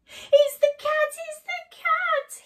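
very high, loud mouse squeal; panicked cry. Acted. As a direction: cry out in exaggerated storybook terror. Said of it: "he cried".

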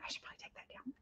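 hushed unvoiced whisper. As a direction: whisper it to yourself. Retold own thought, whispered.

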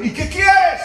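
put-on preacher asking the drunk what he wants. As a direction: call the question out to him. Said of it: "Le digo".

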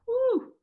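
high, drawn-out falling "whoo"; overwhelmed whoo. Retold or imitated. Learner faced with boyfriend's German, overwhelmed whoo.

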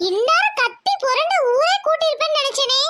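taunting. Acted. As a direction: taunt the victim you tricked. Tormentor taunts the suffering victim. (ஏளனமான குரல்)

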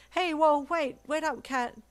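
loud high shout after the dog; calling after. Acted. Deku chasing his pup down the hall, loud call. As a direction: yell down the hall to stop him. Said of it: "Deku called".

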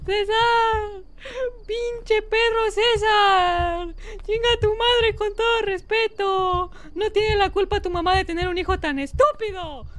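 high, drawn-out wailing insults; tearful, hammed-up rant; mock-crying tirade. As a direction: curse him out while bawling theatrically. Wails insults at monster after scare.

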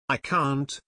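plain reading voice

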